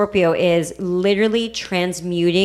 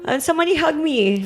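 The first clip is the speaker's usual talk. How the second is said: higher, hammed-up plea for a hug; mock pleading; 8 semitones higher than usual. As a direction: beg for comfort, half joking. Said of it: "Cancer shadow energy is".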